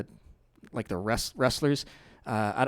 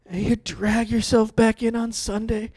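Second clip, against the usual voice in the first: ordinary talk, nothing marked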